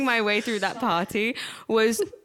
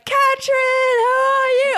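very high, loud gushing hello; hammed-up party greeting from an old boss; mimicked boss greeting; 5 dB louder, slower than usual. Old boss greets her in passing.

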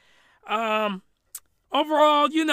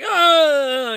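higher, louder drawn-out "oh", hammed up; blissful cry; 7 semitones higher, 8 dB louder than usual. Podcaster showing how bliss should sound, blissful cry. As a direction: let out a long mock cry of bliss. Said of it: "The climax is when you're like".

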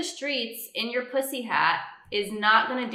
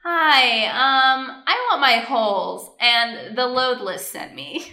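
loud, slow, eager customer order; drive thru customer; 2 semitones higher, 7 dB louder, much slower than usual. Pretend. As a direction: order your holes with gusto. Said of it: "Here, we'll do it".

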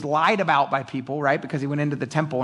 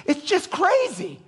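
amazed; slower than usual. Real, not acted. Preacher marvels at events.

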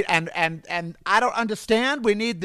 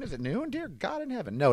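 usual speaking voice

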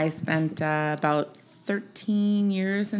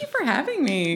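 character voice; 7 semitones higher than usual. Acted. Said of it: "just address me in that voice".

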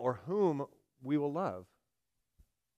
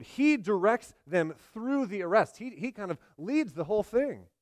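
ordinary talk

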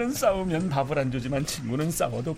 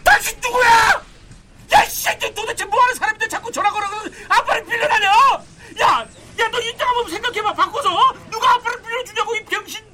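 higher, louder furious yelling; furious shout; 6 dB louder than usual. Acted. Father yelling down the phone at the stranger who wants to borrow a dad, furious shouting. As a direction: scream your rage at the caller.

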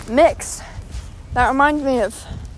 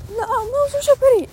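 much higher, emphatic admiration; excited gushing; 11 semitones higher than usual. Real, not acted. Walker cannot get over the pretty quilts, gushing excitedly. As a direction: insist on it in a high gush.

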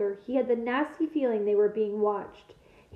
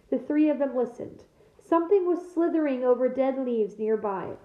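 ordinary delivery

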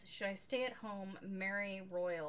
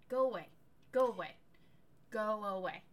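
fed-up shouts; 8 dB louder than usual. Retold or imitated. Tired new mother to pushy husband, repeated loud go away. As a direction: shove him off, louder at first then tiring.

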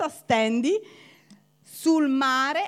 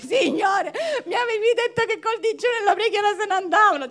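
laughing mock protest; 7 semitones higher, 6 dB louder, faster than usual. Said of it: "e tu dici".

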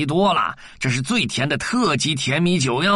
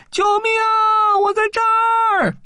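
high, far-off calling cry; lost child calling from a distance; distant cry for help; 3 dB louder, slower than usual. Acted. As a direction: shout for help from far away. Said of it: "远处传来小贝的声音".

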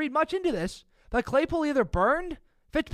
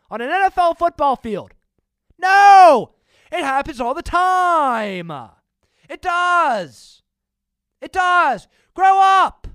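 loud, higher exasperated yelling; angry shouting; 3 semitones higher, 9 dB louder, slower than usual. Real, not acted. Vlogger shouting down Steeler fans, angry and loud. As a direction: blast it at them, fed up.

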